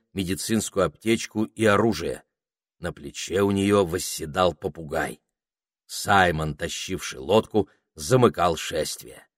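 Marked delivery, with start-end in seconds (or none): none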